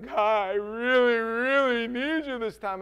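loud, drawn-out mock plea; hammed-up begging, stretching the words slowly; desperate pleading. Acts out his own desperate prayer.

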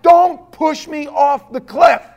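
drawn-out, high, loud protest; pleading shout. Acted. Believer pleads with God at the cliff edge, shouting. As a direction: shout it slowly, resisting the push.

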